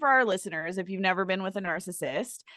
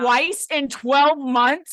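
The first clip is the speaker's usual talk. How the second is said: very slow, louder, higher outraged echo; incredulous echo; 4 semitones higher, 8 dB louder, much slower than usual. Real, not acted. Friend echoing the shocking number, slow, loud and incredulous. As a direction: stretch it out in loud disbelief.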